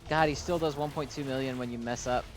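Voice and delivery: usual speaking voice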